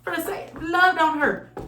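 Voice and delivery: high, fast sassy spirit voice; spirit's voice. Retold or imitated. Reader voicing the spirit's message to the viewer, high and sassy. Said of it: "Spirit's like".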